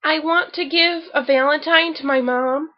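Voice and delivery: usual speaking voice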